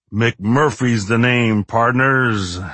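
slow cowboy drawl. Acted. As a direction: stretch it out like a movie cowboy. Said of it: "in his drawling cowboy actor's voice".

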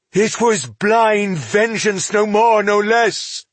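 high, loud condemnation; slow the charge, then hit it hard; bitter outrage. A narrator's reading. Elf condemns dwarf's blind vengeance, outraged.

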